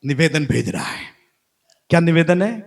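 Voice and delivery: ordinary delivery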